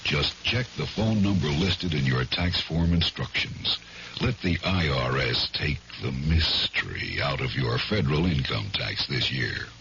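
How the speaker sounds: ordinary delivery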